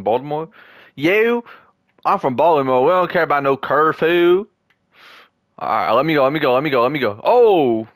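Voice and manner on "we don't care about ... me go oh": normal talking voice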